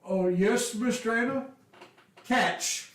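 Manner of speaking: keen muscle answering the boss; eager half-orc guards. Acted. Half-orc guards answer Miss Drana, high and eager. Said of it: "the two half-orcs".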